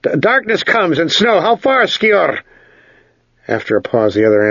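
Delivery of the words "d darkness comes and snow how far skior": stammered, high and anxious; forced speech. A narrator's reading. Ged forces himself to speak to his guide as fear stirs, strained. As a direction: force the words out, pitched high. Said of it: "he forced himself to speak".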